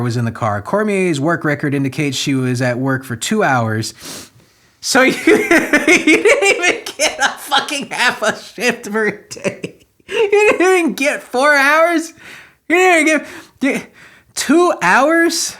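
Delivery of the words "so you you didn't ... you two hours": high squealing disbelief, breaking into laughs; incredulous laughter. Real, not acted. Commentator mocks the two-hour shift, high and incredulous through laughter. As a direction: squeak the words out while cracking up.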